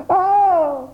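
high-pitched drawn-out terrified "Ah"; cry of terror. A narrator's reading. Frightened boy finds something alive in his pocket, cry of terror. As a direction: let out a shrill scream of fright. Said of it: "en poussant un cri de terreur".

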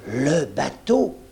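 a doctor suddenly grasping the news, amazed; surprised exclamation. A narrator's reading. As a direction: let it burst out in slow astonishment. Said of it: "s'exclama-t-il".

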